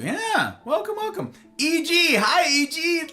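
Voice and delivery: high happy welcome; excited greeting. Real, not acted. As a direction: welcome them in brightly.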